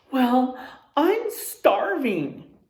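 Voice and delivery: slow, drawn-out starving complaint; hungry Harry. A narrator's reading. Teacher reading Harry to the class, slow hungry moan. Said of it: "he told Gary".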